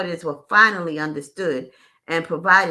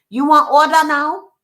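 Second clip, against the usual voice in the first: high, loud, clipped broken-English waitress voice; waitress in broken English, raised pitch and volume; 8 semitones higher, 11 dB louder than usual. Retold or imitated. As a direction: play the curt foreign waitress, louder and higher.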